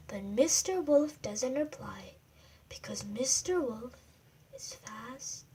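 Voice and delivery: soft whisper for sleeping wolf; bedtime whisper. Acted. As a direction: whisper so he won't wake. Wolf asleep, bedtime ending.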